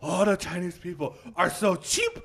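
slow, loud words with high laughter; laughing hard while quoting; laughing through quote. Real, not acted. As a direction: crack up as you tell it.

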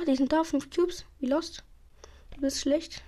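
ordinary delivery

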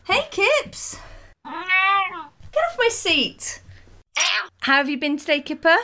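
high, slow voice for a cat; pet talk. Real, not acted. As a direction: coo at the cat. Talking to the cat.

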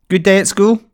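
a hint of a cheerful mother; mum's cheery question. A narrator's reading. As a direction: ask brightly, welcoming her home. Mum greets daughter home.

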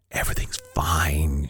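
unvoiced, hushed reassurance; whisper. Wife whispering reassurance to her husband behind the door. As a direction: breathe it softly to him.

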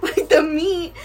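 high, loud, laughing through the words; real giggly, squealing disgust. Real, not acted. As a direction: crack up while grossed out. Laughing over her gory retelling.

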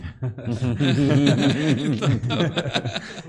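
loud burst of laughter; hearty laugh. Real, not acted. Laughter after teasing.

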